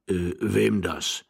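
put-on slow, muddled old voice; befuddled old man. Old husband lost in conversation, slow and befuddled. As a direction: drag the question out, at a loss.

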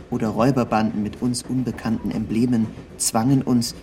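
ordinary talk, nothing marked

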